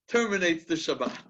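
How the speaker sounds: usual speaking voice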